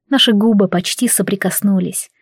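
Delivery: plain reading voice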